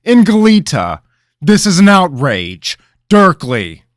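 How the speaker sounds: very high, loud furious shouting; king raging at the news, furious shout; outraged king. Acted. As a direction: rage and summon your servant. King's outburst.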